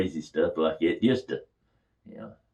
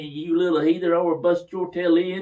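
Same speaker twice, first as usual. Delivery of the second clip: high, loud scolding; scolding mother; 9 semitones higher, 5 dB louder than usual. Retold or imitated. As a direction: scold him like an outraged mom.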